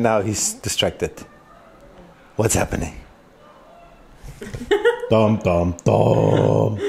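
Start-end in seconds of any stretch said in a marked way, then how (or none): 4.2-5.1 sudden squeaky laugh; a burst of high laughter; high delighted laugh. Real, not acted. Baby does something funny.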